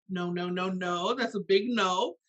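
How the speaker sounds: ordinary talk, nothing marked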